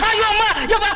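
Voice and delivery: panicked wail. Acted. Fake priest panics, caught out.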